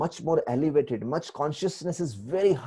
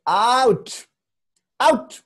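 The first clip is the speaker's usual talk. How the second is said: rising shout, much higher and louder; 10 semitones higher, 8 dB louder, much slower than usual. Retold or imitated. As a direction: yell out at them. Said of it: "Shahrukh Khan बोलते हैं".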